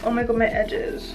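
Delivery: ordinary talk, nothing marked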